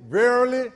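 "Verily" dicho más fuerte y alto, con énfasis solemne; emphatic verily. Shown as a demonstration. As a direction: marca "Verily" con peso. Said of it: "the reason for it twice is for emphasis".

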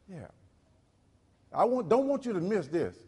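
normal talking voice

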